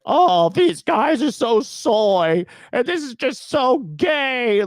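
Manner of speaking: high, stretched-out mocking whine; mock whining. Imitating complainers to ridicule them, mock whining. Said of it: "sit there and be like".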